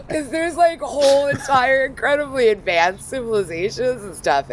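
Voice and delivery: very high-pitched, laughter-filled exclamation; incredulous laughter. Real, not acted. Speaker protesting about whole civilizations, high laughing exasperation. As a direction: squeal it out through the tail of a laugh.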